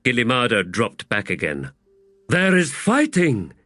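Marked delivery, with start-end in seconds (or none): 2.3-3.5 high, booming giant woman; giant warrior woman. A narrator's reading. Teblor woman's voice.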